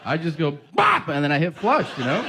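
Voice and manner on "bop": very high loud bop sound; mock bop. Comic miming his toilet routine, squeaky bop. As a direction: pop it out like a cartoon noise.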